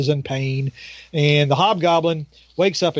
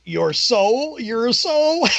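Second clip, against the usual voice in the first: rising higher in mock disbelief; amused demon; 8 semitones higher, much slower than usual. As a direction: toss back the words, climbing in pitch. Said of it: "And he says".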